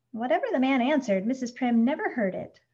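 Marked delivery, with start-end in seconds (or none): none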